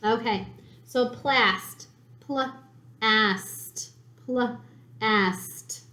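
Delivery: pl-ast stretched slowly into its sounds; sounding out. Shown as a demonstration. Teacher sounds out the word while spelling, stretched. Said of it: "sounding out spelling".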